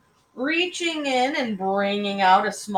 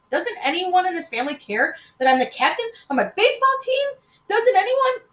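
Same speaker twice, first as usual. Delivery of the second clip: high, loud indignant complaint; kid's outraged, high-pitched protest; exasperated boy; 12 semitones higher, 8 dB louder than usual. A narrator's reading. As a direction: clamour to be heard over everyone. Boy shouting over interrupting family.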